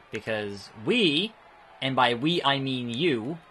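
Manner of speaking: normal talking voice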